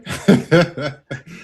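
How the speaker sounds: a burst of real laughter. Real, not acted. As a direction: laugh out at the joke. Laughs at host's remark.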